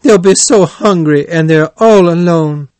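loud, high-pitched lament; laying on the pity, high and loud; plaintive cry. A narrator's reading. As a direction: wail for the poor lonely children. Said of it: "cried Toad".

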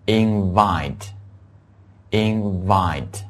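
invite, very slowly with a clear V; slow model word. Shown as a demonstration. Teacher modelling V sound: slow invite. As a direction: drag out invite, V clearly voiced. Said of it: "还有邀请这个词".

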